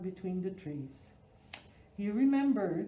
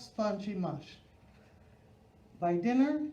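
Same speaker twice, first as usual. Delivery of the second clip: ordinary delivery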